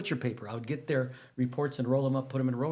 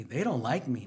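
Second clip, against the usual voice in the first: ordinary delivery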